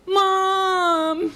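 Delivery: high, long whining call; drawn-out high kid complaint; child calling for mother. Acted. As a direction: tattle to mother at a high pitch.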